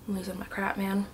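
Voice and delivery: usual speaking voice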